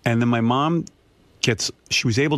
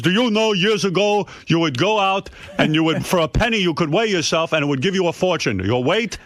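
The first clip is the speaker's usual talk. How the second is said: higher, lecturing mock father; imitating father; 8 semitones higher than usual. Father lecturing about penny scales, higher mock voice. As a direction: lay down the old rule like dad. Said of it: "he says".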